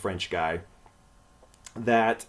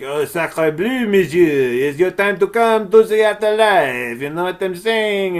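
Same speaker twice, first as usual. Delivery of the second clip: hammed-up French accent, higher and louder; mock French envoy from the afterlife; 6 semitones higher, 6 dB louder than usual. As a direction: play a cartoonish Frenchman summoning him. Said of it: "And he's like".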